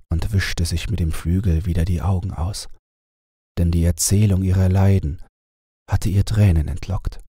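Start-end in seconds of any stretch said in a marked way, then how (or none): none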